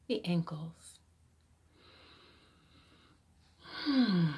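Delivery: calm body-scan voice with long pauses; guided meditation. Acted. Coach guides seated listeners through body scan, guided meditation. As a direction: guide them gently from one body part to the next.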